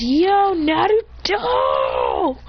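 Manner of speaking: high, long-stretched hail; calling out. Acted. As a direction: holler the name across the village, drawing it out. Character hails friend on arrival.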